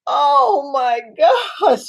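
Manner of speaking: loud, high broken sob; patient hears the news again, breaking into a loud sob; sobbing cry. Acted. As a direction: let it break into a wail. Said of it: "She starts crying".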